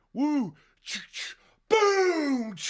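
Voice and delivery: high loud mock gunshot blast; shotgun boom. Buddy fires a shotgun into the brawl, loud mock boom. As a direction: bark the blast like a shotgun going off.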